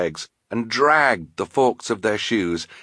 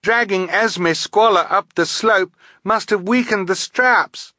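high, loud and alarmed; raised, high-pitched urgent voice; urgent yell; 12 semitones higher, 4 dB louder than usual. A narrator's reading. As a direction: call out the cause of the emergency. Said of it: "she yelled".

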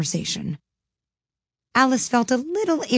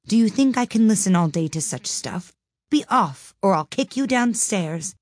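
poem recitation; 4 semitones higher than usual. Acted. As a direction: recite it like a schoolgirl. Recites the Father William poem.

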